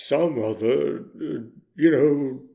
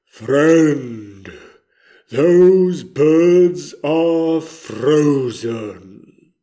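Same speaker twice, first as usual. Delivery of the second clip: slow, louder, drawn-out words; slow pronouncement; 6 dB louder, much slower than usual. Retold or imitated. Telling his friend Orville about the pigeons, slow pronouncement. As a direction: declare it with weight, word by word. Own grave announcement to Orville, retold.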